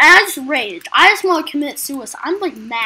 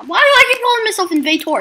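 exasperated; 8 semitones higher, 6 dB louder, faster than usual. Real, not acted. Frustrated at own slip.

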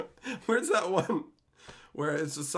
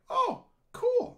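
much higher mock "oh cool"; mock impressed. Streamer retelling first seeing YouTube shows, mock impressed. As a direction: squeak it out, pleasantly surprised. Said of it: "And I was like".

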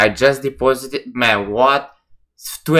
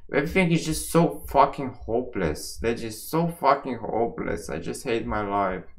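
soft, sinking despair; real quiet despair; hopeless; 8 dB quieter than usual. Real, not acted. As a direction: let it fade into hopelessness. Venting after losing all money.

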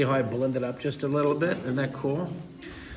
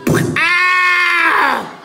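very high, loud comic scream; sudden long "ow!" as a whacked fish; little fish's yelp; 11 dB louder than usual. Pretend. Little fish gets whacked by shark.

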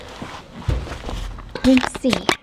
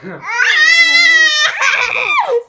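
laughing. Real, not acted. Laughing with baby.

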